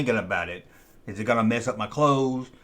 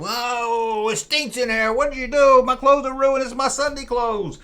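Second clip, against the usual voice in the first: high, louder whiny complaint; upset friend; 10 semitones higher, 4 dB louder, slower than usual. Retold or imitated. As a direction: whine like a kid whose good clothes are ruined. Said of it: "at first he was mad".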